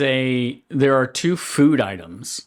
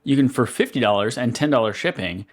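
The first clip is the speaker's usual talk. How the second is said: normal talking voice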